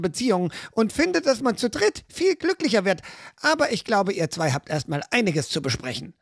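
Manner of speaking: high, sing-song mocking voice; teasing young woman. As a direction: needle them with sweet, high mock innocence. Fiala teases the kidnappers. (eine Frau neckt die Entführer durch die Tür: hoch und spöttisch)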